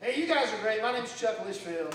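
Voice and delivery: ordinary talk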